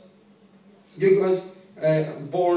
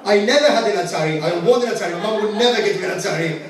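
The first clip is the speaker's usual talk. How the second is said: mock indignant outburst; 2 semitones higher, 5 dB louder than usual. Mock outburst at an audience member.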